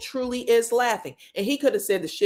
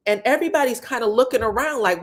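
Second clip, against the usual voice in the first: ordinary delivery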